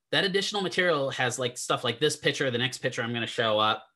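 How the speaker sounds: ordinary delivery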